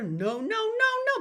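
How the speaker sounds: high, slow, loud call of No, no, no, no. Shown as a demonstration. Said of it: "take it higher and higher".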